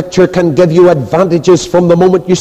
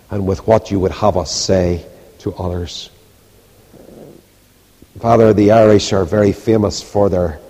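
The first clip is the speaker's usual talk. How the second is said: prayer; 9 semitones lower than usual. Acted. Preacher leading the congregation in closing prayer, low and hushed.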